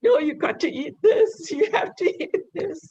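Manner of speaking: mock-stern coaxing, breaking into laughter; laughing insistence. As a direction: laugh while playfully ordering mum to eat. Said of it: "I'm just like".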